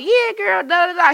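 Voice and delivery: put-on high, chatty girlfriend phone voice; retold phone chat. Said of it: "was on the phone like".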